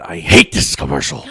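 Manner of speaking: low, mock-angry grumble; imitated anger. Host quoting a wrestler friend hating an ad, mock angry. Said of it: "How do you get angry at that".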